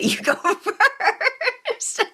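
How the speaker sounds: laughing through the words, slow; can't hold back a laugh while saying it; giggly real laugh over the line. Real, not acted. Laughs while handing over turn.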